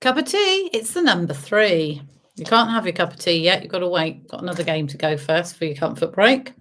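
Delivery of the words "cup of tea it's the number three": bingo caller. Acted. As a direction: sing out the numbers. Bingo calls.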